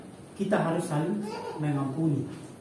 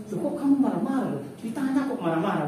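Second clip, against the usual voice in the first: puzzled Javanese listener; 7 semitones higher, 3 dB louder than usual. Retold or imitated. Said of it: "yang dengar itu marah".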